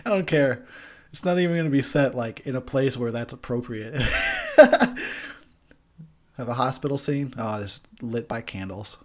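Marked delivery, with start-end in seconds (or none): 4.0-5.3 a sudden high squeal of laughter; high laughing shriek. Real, not acted. Laughing at his own candle rant, a high squeal.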